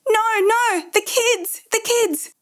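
high, desperate cry without full volume; frantic mother's wail, narrated; panicked scream. A narrator's reading. Mother cries out for her kidnapped children, screaming. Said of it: "Sean screamed".

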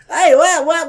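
high, loud mock "wow"; how others imitate him. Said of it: "when they do me is like".

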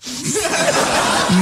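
burst of laughter. Real, not acted. Laughing at colleague's teasing.

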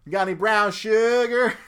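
high, loud character line; hammed-up movie quote. Friend quoting Bad Boys to co-host, loud hammed-up voice. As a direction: call it out high like a film impression.

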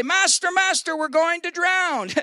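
high, hammed-up panic; panicked cry. Disciples begging their master in the storm, panicked cry. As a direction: wail it as a mock-desperate plea.

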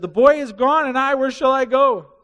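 lightly voiced anguish of a brother; distressed Reuben. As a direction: cry out in lost despair.

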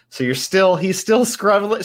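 usual speaking voice

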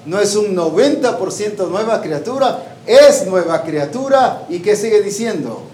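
ordinary talk

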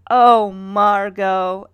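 long, drawn-out loud swooning; mock lovestruck. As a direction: stretch it out like a smitten schoolboy. Acting out a smitten boy's sigh.

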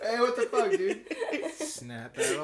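high, loud, laughing mock outrage; mock shout, laughing. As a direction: yell playfully at the imaginary filmer. Mock calling out prankster.

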